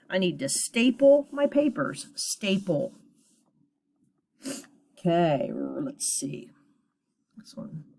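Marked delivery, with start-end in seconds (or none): none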